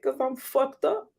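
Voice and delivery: high, breaking, half-laughing; rueful admission. Retold or imitated. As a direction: confess it with a cracked voice. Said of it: "I was like".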